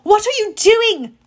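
high, loud demand; yelled. A narrator's reading. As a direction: yell it across a battlefield. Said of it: "Zoe yelled".

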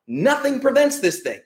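heated rant. Real, not acted. Host ranting to listeners about prevention claims, heated and high. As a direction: hammer the point home.

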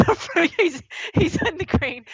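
high, giggly, breaking into laughter; helpless giggle carrying the words; laughing through words. Real, not acted. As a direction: try to explain while cracking up. Cracks up while explaining.

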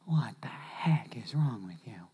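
slow exasperated whisper; whispered rebuke. Acted. Jesus looking down at the hypocrites, slow whisper. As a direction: hiss it under your breath in disbelief. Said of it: "Jesus is looking down going".

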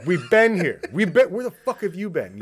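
put-on loud, cranky old-man grumbling about kids; old curmudgeon. Podcaster hamming up an old curmudgeon ranting about late kids, loud and cranky. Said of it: "allow me to be an old curmudgeon".

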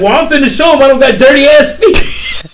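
high, loud indignation through laughter; hammed-up defiant shout, laughing; laughing mock protest. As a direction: act out her protest while cracking up. Retold protest, teller cracking up.

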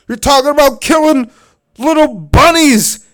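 outraged high, loud shout, imitated; Uncle Bob losing it. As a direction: yell it like an appalled animal-loving uncle. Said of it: "loses his mind".

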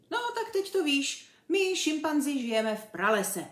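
high, brisk chimp voice; animated animal setting someone straight; know-all chimp. A narrator's reading. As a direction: announce it smugly to the novice. Chimp character lectures Ferda.

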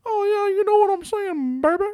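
put-on high, sassy old grandma voice; E-Gag voice. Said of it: "my E-Gag voice".

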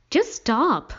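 high, loud, urgent interruption; alarmed exclamation. A narrator's reading. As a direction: cut them off in alarm, pitch leaping. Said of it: "I exclaimed in alarm".

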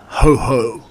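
lightly voiced ominous creature; a hint of a menacing beast's greeting; Heffalump voice. A narrator's reading. Pooh imagining what the Heffalump will say, ominous. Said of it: "the sort of voice this Heffalump was going to say it in".

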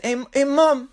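high-pitched precocious child imitation; put-on high British-Australian kid voice; Stewie. Kid nags his sleeping mom, high Stewie-like imitation. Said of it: "It's like the British Stewie".

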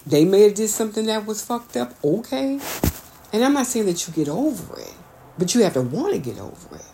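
ordinary delivery

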